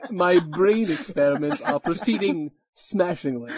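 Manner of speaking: slow, loud, pompous villain voice; posh villain voice. Pretend. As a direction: savor each word like a gloating mastermind. Parodying Hugo Weaving as writer.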